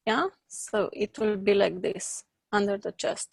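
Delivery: ordinary talk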